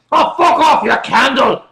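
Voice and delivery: loud, high-pitched Glasgow accent; Glaswegian insult shout. Shown as a demonstration. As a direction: holler abuse like a mock Glaswegian. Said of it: "that's something a Glaswegian can say".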